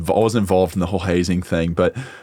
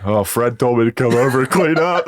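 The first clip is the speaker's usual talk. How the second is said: imitating young pledge; 4 semitones higher than usual. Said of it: "And he's like".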